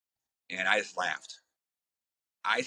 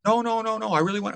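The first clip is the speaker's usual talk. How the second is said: mock pleading; 11 semitones higher, 3 dB louder than usual. Mimics the corporate guy pleading.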